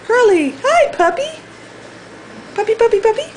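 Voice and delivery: very high coaxing call to the puppy. Real, not acted. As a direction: call the puppy over sweetly. Calling the puppy.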